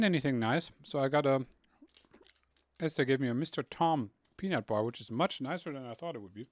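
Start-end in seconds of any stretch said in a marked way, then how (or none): none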